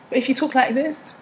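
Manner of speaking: British accent, lifted for contrast. Shown as a demonstration.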